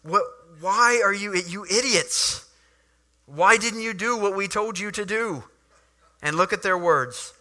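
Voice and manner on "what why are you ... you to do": a hint of exasperated priests; hammed-up rebuke; annoyed leaders scolding the guards; mock angry scolding. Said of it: "The Pharisees say to them".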